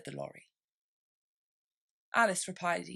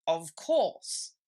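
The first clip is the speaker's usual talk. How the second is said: grave dodo; 5 dB louder than usual. A narrator's reading. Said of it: "replied very gravely".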